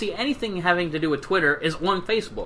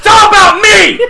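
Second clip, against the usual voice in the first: very high, loud laughing shout; 14 dB louder, slower than usual. Acted. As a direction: yell it, hamming up the diva. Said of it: "you just blew out the mic".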